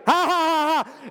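hammed-up giggle of a hiding child; mock toddler giggle.

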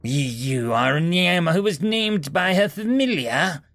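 high, slow, angry demand; angry noble. Acted. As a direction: look down on them and demand. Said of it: "demanded angrily".

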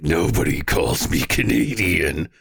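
low, slow, put-on macho voice; mock offended tough guy. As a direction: drop it deep and draw out the warning. Acting offended Australian Preston.

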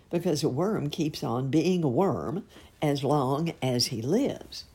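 usual speaking voice

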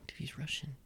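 whispering he's Russian as a warning; hushed warning. Real, not acted. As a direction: whisper it under the breath. Warning friend about a Russian.

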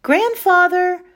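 loud, high call from far off. A narrator's reading. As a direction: call for help from the roof. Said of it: "the twins called".

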